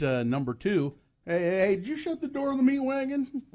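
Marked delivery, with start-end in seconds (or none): 1.2-3.4 high-pitched hammy shout; yelling like a goofy coroner; high mock yell. Acted quote of coroner.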